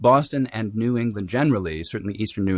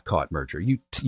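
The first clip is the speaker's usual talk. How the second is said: ordinary talk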